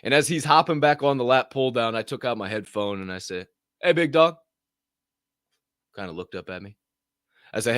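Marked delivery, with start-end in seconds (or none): 3.8-4.3 louder call, higher. Retold or imitated. Stopping the kid at the lat pulldown, calling out. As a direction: get his attention across the gym.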